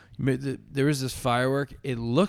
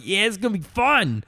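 put-on excited kid; 9 semitones higher, 5 dB louder than usual. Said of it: "sitting there like".

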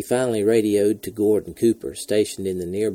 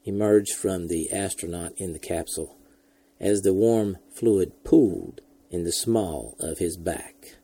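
usual speaking voice

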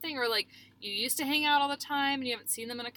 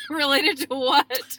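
mock outrage; 2 semitones higher, 8 dB louder, slower than usual. Real, not acted. Teased about keeping secrets.

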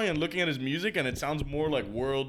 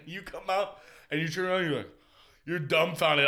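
usual speaking voice

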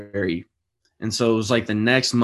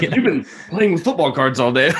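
higher, louder, laughing dad retort; exasperated dad; 6 semitones higher, 4 dB louder than usual. Retold or imitated. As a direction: play the tired dad snapping back. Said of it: "He's like".

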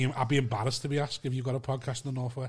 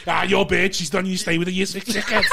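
loud, raised jokey abuse; mock roast insult; 4 semitones higher, 5 dB louder than usual. Podcaster showing his failed roast jokes, loud mock abuse. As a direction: hurl crude insults half laughing.

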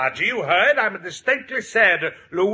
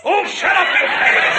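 loud scolding shout; snapping shout; 12 semitones higher, 6 dB louder than usual. Acted. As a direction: shut her up loudly. Father scolds Rosa.

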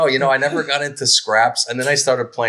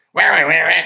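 mock angry duck squawks, slightly higher; angry duck call; 5 semitones higher than usual. Imitating the jealous drake squawking at the other male. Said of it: "he looks at the other male duck and goes".

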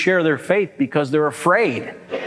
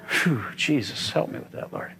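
quiet plea; 3 semitones lower, 8 dB quieter than usual. Real, not acted. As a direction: breathe it out as a little prayer. Sigh into a quiet prayer.

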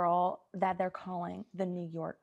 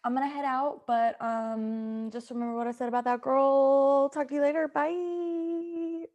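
chirpy goodbye; 5 semitones higher, 5 dB louder than usual. Acted. Gossip girl leaving.

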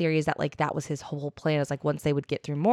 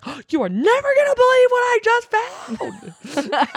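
much higher, louder excited cry; excited shout; 7 dB louder than usual. Retold or imitated. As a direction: burst out with thrilling news. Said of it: "he's like".

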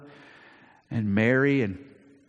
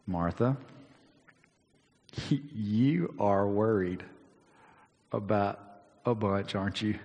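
compassionate. Retold or imitated. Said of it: "and with compassion".

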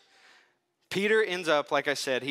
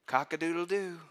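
mock rooster crow; 3 semitones higher than usual. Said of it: "Jesus could have looked at Peter and said".

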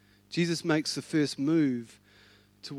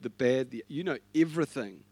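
ordinary delivery

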